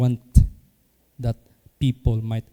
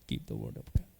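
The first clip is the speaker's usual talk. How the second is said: deep low refrain; 14 semitones lower, 12 dB quieter, faster than usual. Real, not acted. Pastor to the congregation, sinking deep and hushed. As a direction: keep the word of God dropped much lower and softer.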